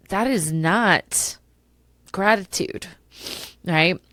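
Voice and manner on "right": ordinary talk, nothing marked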